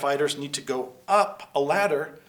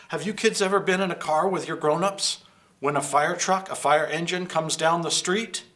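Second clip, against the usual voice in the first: ordinary delivery, nothing marked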